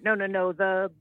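normal talking voice

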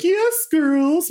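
very high, camp villain falsetto; high-pitched theatrical drag-devil voice; Him from the Powerpuff Girls. Retold or imitated. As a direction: coo it like a campy cartoon diva. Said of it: "him from the Powerpuff Girls being like".

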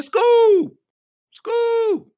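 very high, loud drawn-out holler; high-pitched yell of greeting; excited shout. Acted. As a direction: holler the nickname out like a rowdy fan. Acts out calling Scoob on meeting.

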